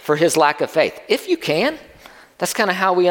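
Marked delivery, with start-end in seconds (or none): 1.1-1.8 questioning rebuke with a lifted pitch; criticizing. Acted. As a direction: echo it back as a mild, pitched-up challenge. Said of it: "Jesus is criticizing this father".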